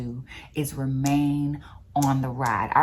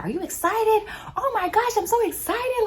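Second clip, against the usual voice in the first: very high, gushing squeal; excited girl. Retold or imitated. Girl turns to a nervous rider on roller coaster, excited squeal. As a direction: imitate an over-excited girl, pitch way up. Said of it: "This girl turns around".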